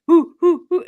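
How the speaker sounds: high, loud hooting cheer; mock hooting cheer. Watching live streams, she hoots along loudly. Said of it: "And I was going".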